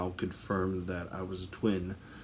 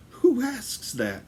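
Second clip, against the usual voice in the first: incredulous; 6 dB louder, slower than usual. Retold or imitated. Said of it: "It's like".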